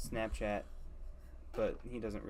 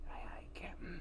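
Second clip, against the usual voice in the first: whispering; 12 dB quieter than usual. Pretend. Skeleton answer in whisper.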